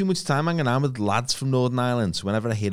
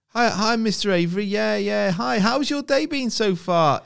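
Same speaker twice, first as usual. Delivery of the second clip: very high-pitched, chirpy sales patter; mock over-friendly phone voice; over-friendly cold caller; 12 semitones higher, 4 dB louder, slower than usual. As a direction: gush like a fake-matey telesales caller. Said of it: "over friendly bollocks from someone".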